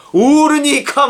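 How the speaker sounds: 옳으니까 thrown out high in mock protest; indignant challenge. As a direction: imitate the prophet's incredulous challenge. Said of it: "막 이러고 있는 거예요". (높은 소리로 따져 묻는 흉내)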